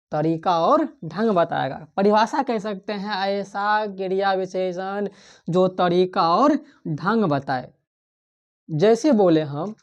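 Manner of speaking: ordinary talk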